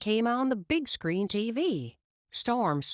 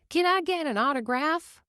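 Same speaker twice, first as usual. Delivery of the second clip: put-on high, hopeful kid's voice asking; a lightly voiced kid begging for a signature; eager young fan; 10 semitones higher, 3 dB louder than usual. A narrator's reading. Young fan asks racer Storm for an autograph, eager kid voice. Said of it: "said one young fan".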